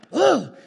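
loud, high fed-up groan; grandmother's exasperated ugh; irritated groan. Retold or imitated. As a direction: let out a big annoyed groan. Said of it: "she's like".

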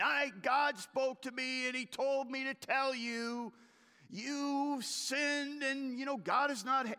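high, loud, slow mock rebuke; hammed-up prophet barging in with blame; mock guns-blazing accusation. Imagined Nathan confronting David, loud mock accusation. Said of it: "He doesn't come at David with guns blazing".

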